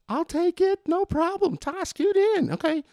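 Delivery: very high, put-on woman's voice; imitated girlfriend. Girlfriend offers to take the picture, high imitated voice. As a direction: pitch it way up as an obliging girlfriend.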